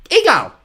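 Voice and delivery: very high, loud little shriek. A narrator's reading. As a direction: shriek it out like a small child. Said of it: "Sonny shrieked".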